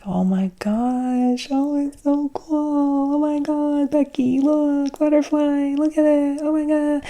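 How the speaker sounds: high, slow, squealing mock-girly gush; hammed-up swooning over a butterfly. Gushing onlooker at a butterfly.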